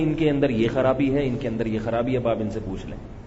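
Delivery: restrained, quietly respectful retelling; polite, mannerly. Retold or imitated. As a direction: voice a respectful complaint, gently tailing down. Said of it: "اس کی بیوی نے اتنی تمیز سے بات کی".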